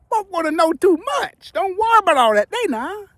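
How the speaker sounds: high, teasing brush-off; playful scolding. Acted. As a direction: wave off the nosy ones.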